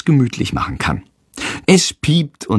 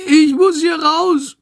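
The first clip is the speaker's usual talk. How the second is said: very high, tearful squeal; desperate bat; slower than usual. A narrator's reading. Trapped sick bat begs to get out, wailing high. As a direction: wail slowly in a tiny panicked voice.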